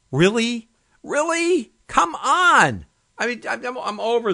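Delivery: high exasperated outburst, loud. Real, not acted. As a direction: throw up your hands, exasperated. Exasperated rant about weather.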